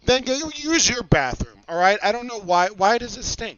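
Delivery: dad calling to his daughter about the bathroom. Real, not acted. Parent answering child about bathroom.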